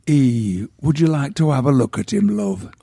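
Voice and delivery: lightly voiced northern English widow, kindly; a hint of an old Yorkshire mourner offering a look; northern English accent. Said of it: "attempt to do the accent of my youth".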